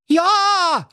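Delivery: high, loud yes; prince calling out. Acted. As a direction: blurt out an eager yes. (hoher, lauter Ausruf)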